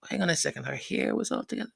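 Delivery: quick, quiet suspicious aside; hushed aside. Real, not acted. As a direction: lean in and drop it low.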